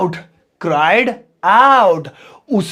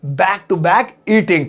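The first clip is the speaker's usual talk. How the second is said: slow pronunciation demo; much slower than usual. Shown as a demonstration. Teacher modelling back to back eating for students, very slow. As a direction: back to back eating spelled out very slowly. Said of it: "इसे आप बोलेंगे back to back eating".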